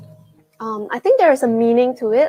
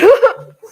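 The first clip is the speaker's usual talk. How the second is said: embarrassed laugh; 11 semitones higher, 11 dB louder than usual. Real, not acted. Laughs at talking while chewing.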